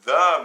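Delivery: "the" punched hard and loud; a sharp loud stab on "the"; stressed "the". Shown as a demonstration. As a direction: hit "the" with a loud jolt. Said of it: "makes it formal".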